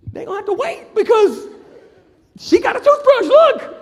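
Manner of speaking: very high, loud squealed protest; hammed-up frightened shriek; mock panic. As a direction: squeal it in comic alarm. Frightened protest about armed inmate.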